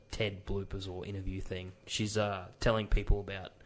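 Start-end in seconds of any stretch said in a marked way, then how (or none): none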